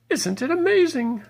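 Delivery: a charmed lady from Beacon Hill marvelling; amazed old lady. Acted. Old lady voiced in story.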